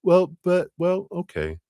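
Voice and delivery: high flustered stammer. Retold or imitated. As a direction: sputter in surprise.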